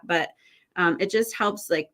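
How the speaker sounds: normal talking voice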